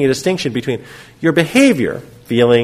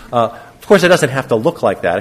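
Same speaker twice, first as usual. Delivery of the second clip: usual speaking voice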